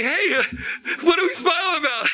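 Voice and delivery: high, giggly and delighted without knowing why; smiling, laughing. Acted. Lover catching a loved one's smile, giggling along. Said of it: "You start smiling".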